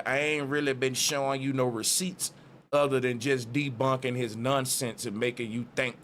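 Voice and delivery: normal talking voice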